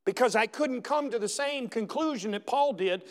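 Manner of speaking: normal talking voice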